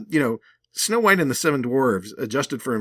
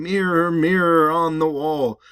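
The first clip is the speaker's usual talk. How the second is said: slow, louder, higher chant casting a spell; spell incantation; 5 semitones higher, 7 dB louder, slower than usual. Retold or imitated. Queen casting a spell on the mirror, slow loud incantation. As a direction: intone it like a spell to awaken the mirror. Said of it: "it was an incantation".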